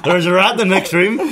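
laughing. Retold or imitated. Joke retelling, laughing.

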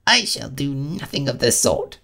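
high, huffy mouse refusal; offended Mouse. A narrator's reading. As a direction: refuse with wounded dignity.

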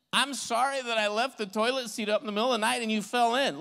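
fast, high-pitched playful sorry; rushed comic apology. Acted. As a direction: blurt out a silly confession in one run. Acted apology for a silly mistake.

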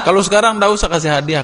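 normal talking voice

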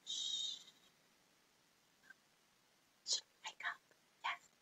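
soft hushed whisper. Shown as a demonstration. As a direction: whisper it gently. Whispered wake-up.

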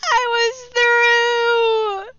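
very high, loud wailing noes; anguished shouting. Real, not acted. Gamer wailing as he dies at the finish, anguished. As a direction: wail in disbelief. Gamer loses right at the end.

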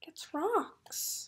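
hushed suspense whisper. Real, not acted. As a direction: lean in and whisper. Suspenseful storytelling aside.

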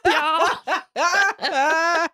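high, goofy ghost greeting with a laugh. Acted. Host voicing the cellar ghost greeting guests, high and goofy. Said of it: "And Gauche says".

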